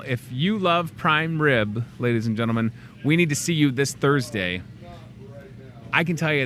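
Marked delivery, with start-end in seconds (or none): none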